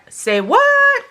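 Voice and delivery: very high, loud, stretched disbelief; mock astonishment. Vlogger retells spotting the kimchi item, in high mock astonishment. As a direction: shoot up high on a drawn-out what.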